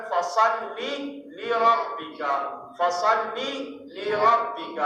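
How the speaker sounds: فَصَلِّ لِرَبِّكَ with both lams clearly held apart; correct pronunciation. Shown as a demonstration. Teacher shows students how to keep both lams in فَصَلِّ لِرَبِّكَ. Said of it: "تو ہم کو کیسے پڑھنا ہے".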